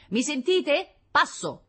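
high, loud radio check; loudspeaker voice. A narrator's reading. Scout checking the general hears him, high and loud. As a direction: call insistently into the radio, pitched high. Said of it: "riprese l'altoparlante".

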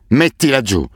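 angry shout. A narrator's reading. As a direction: roar the command at the child. Asef screaming at the boy.

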